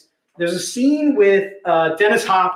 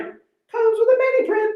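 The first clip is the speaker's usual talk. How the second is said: repeated much higher and slow, teasing; playful sing-song; 14 semitones higher, slower than usual. Acted. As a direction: sing it out high like a game-show host.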